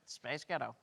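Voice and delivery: a light American accent. Shown as a demonstration. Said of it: "In an American accent".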